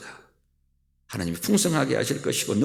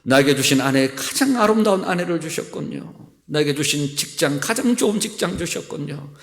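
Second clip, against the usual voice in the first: ordinary delivery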